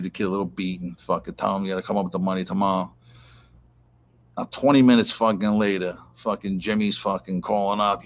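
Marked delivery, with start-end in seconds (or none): none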